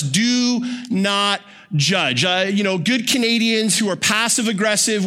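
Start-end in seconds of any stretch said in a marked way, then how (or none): 0.0-2.2 "Do not judge" spoken very slowly, each word weighted; mock reverent recital of the Canadian life verse; slow emphatic quote. Shown as a demonstration. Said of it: "We love this verse".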